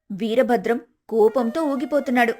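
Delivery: ordinary delivery, nothing marked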